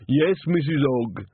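put-on slow, high, childlike voice; slow simple man. A narrator's reading. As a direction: answer slowly like a simple soul.

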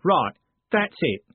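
slow, loud angry roar. Acted. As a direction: roar it out in anger. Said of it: "Jim roared".

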